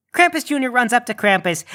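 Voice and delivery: ordinary talk, nothing marked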